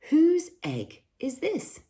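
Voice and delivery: put-on high, slow calling boy's voice. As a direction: call out to whoever owns the egg, pitched high. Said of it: "he called".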